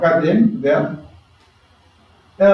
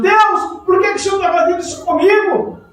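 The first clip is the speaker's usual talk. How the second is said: much higher, fast indignant protest; a believer questioning God in hardship, indignant; complaining to God; 12 semitones higher, faster than usual. Retold or imitated. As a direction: demand an answer from God, aggrieved. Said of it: "Muitas vezes nós falamos assim com Deus".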